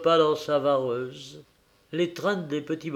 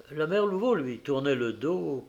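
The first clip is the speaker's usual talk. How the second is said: ordinary delivery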